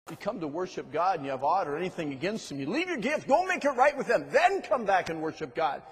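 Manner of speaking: loud, high, climbing sermon delivery; full force preaching. Acted. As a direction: drive it home to the congregation.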